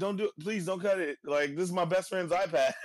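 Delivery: high mock pleading voice; high, whiny plea from a captive iPad; pleading Stan. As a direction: squeak out a begging plea.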